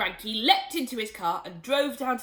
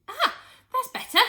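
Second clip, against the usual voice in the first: put-on much higher relieved boy's voice; pleased sigh; 9 dB louder than usual. A narrator's reading. George relieved at the colour, high and pleased. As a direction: settle back relieved. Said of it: "George said".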